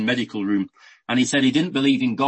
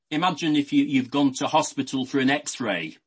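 ordinary delivery

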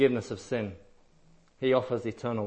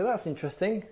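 lightly mocking churchgoer, higher and airy; sing-song, higher, mock polite praise; polite mild interest; 4 semitones higher than usual. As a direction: mimic someone politely unmoved. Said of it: "they might say".

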